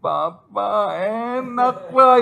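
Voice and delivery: high, slow falsetto wife voice; hammed-up high-pitched wife; mock moaning wife. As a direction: ham up the wife's moan in a high, drawn-out voice. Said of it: "Dia bilang".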